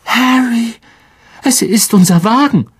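high, excited call; overcome with relief. A narrator's reading. Ron discovering their car, overcome with relief. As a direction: cry it out in relief. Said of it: "die Stimme versagte ihm vor Erleichterung".

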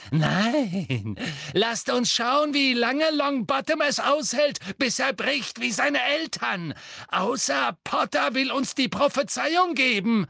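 gloating witch. A narrator's reading. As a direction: relish his torment, sing-song. Bellatrix gloating, voiced character. (grausame, entzückte Hexe, viel höher und lauter)

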